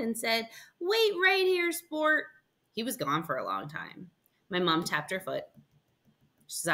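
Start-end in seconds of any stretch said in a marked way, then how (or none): 0.8-2.2 high, lightly voiced barber; chummy barber. Barber telling the boy to wait, chummy and high. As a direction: tell the kid jovially, pitched up.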